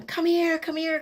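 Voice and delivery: high coaxing call to a calf. Retold or imitated. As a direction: sweet-talk the calf over. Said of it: "So I'm going".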